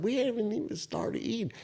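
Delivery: high, hushed disbelief; acted-out silent objection, small and high; incredulous inner thought. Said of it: "in my head, I was like".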